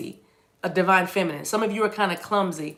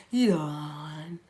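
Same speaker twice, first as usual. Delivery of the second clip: exaggerated drawn-out modulated greeting; mock voice-class graduate; 3 semitones lower, 5 dB quieter than usual. Reader mocking trained speakers, drawn-out swooping greeting. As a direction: stretch it slowly, swooping at the end. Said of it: "now every time you say something".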